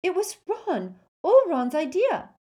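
high, fast, excited rush; breathless excitement. Acted. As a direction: gush out the story breathlessly. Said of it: "said Hermione breathlessly".

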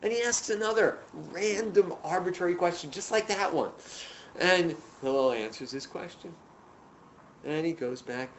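ordinary delivery

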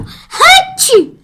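loud mock sneeze; sneezing. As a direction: fake a big sneeze. Child mimics sneeze for allergy.

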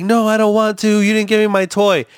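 put-on high, whiny child refusing; imitated protesting kid. Kid arguing back about a toy, high mock child voice. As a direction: mimic a kid's high-pitched protest. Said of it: "And the kid was like".

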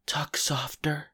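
breathy whisper, slow and faint. Retold or imitated. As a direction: whisper it like a fragile patient.

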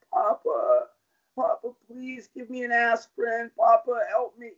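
high, pleading mock cry; imitated crying child. Hurt daughter begging papa for aspirin, imitated crying. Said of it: "and she's crying".

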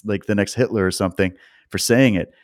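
normal talking voice

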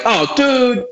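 high, drawn-out oh dude; dismayed realization. Retold or imitated. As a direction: realize the producer's mistake. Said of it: "And I'm like".

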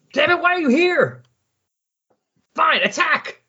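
high-pitched mock yell of frustration; exasperated villain outburst. Villain ranting at an unresponsive Celestial, exasperated high yell. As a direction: play a villain losing it, voice shooting way up.

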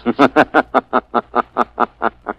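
low sinister chuckle; mad doctor chuckle. Acted. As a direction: laugh like a madman. Mad surgeon laughs.

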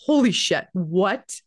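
imitated astonishment; retold shock. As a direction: act out friends' shocked reaction. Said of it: "everyone's faces just are in shock".

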